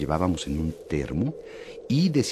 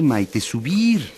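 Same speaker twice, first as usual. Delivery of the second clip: subir alargado y enfático; stress on subir; 8 semitones higher, 5 dB louder, much slower than usual. Acted. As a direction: recalca despacio subir. Insisting on going up. (subir dicho lento y marcado)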